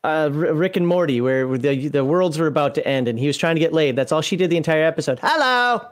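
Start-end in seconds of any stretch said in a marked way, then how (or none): 5.2-5.8 higher, hammed-up cartoon hello; teasing impression, pitched up. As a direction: imitate an over-eager cartoon lady's greeting. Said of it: "sound like the, the lady from".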